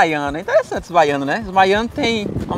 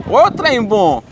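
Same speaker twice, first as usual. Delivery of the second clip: put-on playful Minas Gerais accent; 6 semitones higher, slower than usual. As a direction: praise it like a proud mineiro. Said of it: "Um exemplo assim".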